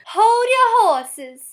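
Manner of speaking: high, drawn-out Southern drawl without R's; sassy Southern belle. Shown as a demonstration. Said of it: "portray a sassy Southern belle".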